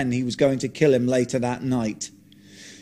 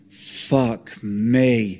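plain reading voice

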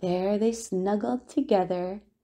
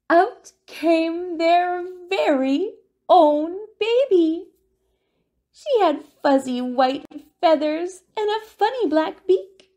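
delighted reveal; 8 semitones higher, 5 dB louder than usual. Acted. Baby penguin hatches.

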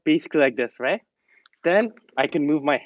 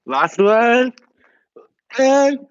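high, loud cheer for the last rep; rally them home with a big lift; excited final push; 10 semitones higher, 6 dB louder, much slower than usual. Real, not acted. Coach cheers the class through the final rep, high and loud.

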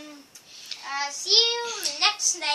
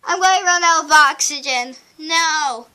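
normal talking voice

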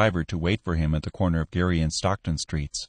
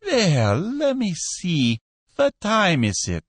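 high, unhurried dealer voice; raised shopkeeper voice; shopkeeper character voice; 9 semitones higher, slower than usual. Acted. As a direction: stall him, slow and easy. B. Cohen voiced on phone.

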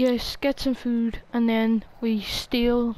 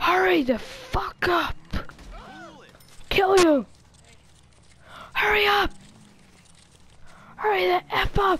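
angry yelling; 8 semitones higher than usual. Acted. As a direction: scream at the clerk to hurry. Robbing the store in game.

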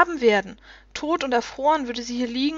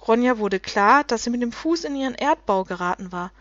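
ordinary delivery

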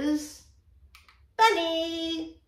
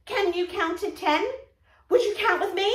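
bunny puppet; 7 semitones higher than usual. Acted. Teacher voicing Bunny puppet.

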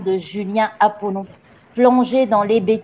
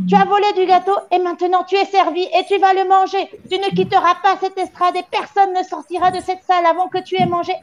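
high, fast furious tirade; explosive rage; 9 semitones higher than usual. A narrator's reading. Le Gourdin exploding at the gamin over the cake, furious tirade. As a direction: explode and rant without letting up. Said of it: "mademoiselle Le Gourdin explosa".

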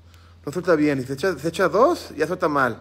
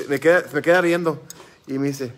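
normal talking voice

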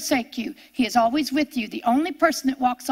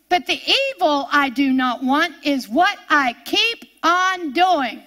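scripture reading; 3 semitones higher than usual. Acted.

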